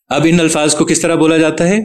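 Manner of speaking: normal talking voice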